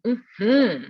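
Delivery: mm-HMM, leaning into the second syllable; last-syllable stress. Shown as a demonstration. Teacher models a gut yes, mm-HMM stressed last. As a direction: hum "mm-hmm" with a swell on the end. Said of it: "emphasis is on the last syllable".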